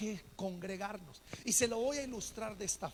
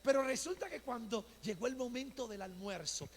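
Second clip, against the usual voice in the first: ordinary talk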